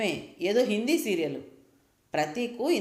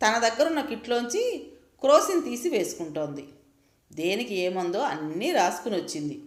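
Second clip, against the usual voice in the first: usual speaking voice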